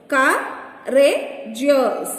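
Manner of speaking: "Courageous" slowly in three beats, Cou, ra, geous; slow, broken-up "Courageous" for counting; syllable by syllable. Shown as a demonstration. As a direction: pause between the syllables of "Courageous". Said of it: "Three syllables".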